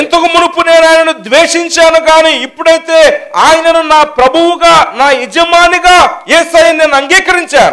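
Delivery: loud, high-pitched sermon delivery; full-force preaching. Real, not acted. Interpreter voices a preacher's testimony, preaching at full force. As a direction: belt out the confession to the congregation.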